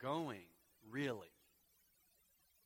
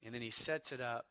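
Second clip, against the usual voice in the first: ordinary delivery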